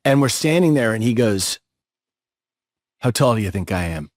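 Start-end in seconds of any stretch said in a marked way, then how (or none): 3.0-4.1 low mock-menacing question; imitated gruff challenge. Short actor challenges his scene partner, low imitation.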